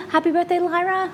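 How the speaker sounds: high sing-song voice for a pet. Real, not acted. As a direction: coo at the dog. Greeting the birthday dog.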